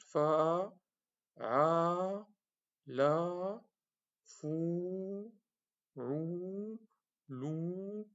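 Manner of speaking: "fou, rou, lou" held long at steady pitch; prolonged vowels. Shown as a demonstration. Teacher drilling long vowels for students to copy. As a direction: sustain every vowel, rising slightly. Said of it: "Madda parce qu'on prolonge".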